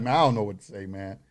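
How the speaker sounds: low, soft, falling away at the end; resigned mumble. Retold or imitated. Creator replaying being at a loss with people's questions, quiet resignation. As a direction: act out giving up, voice dropping right down.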